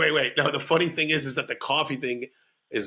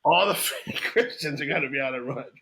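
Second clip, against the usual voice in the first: ordinary delivery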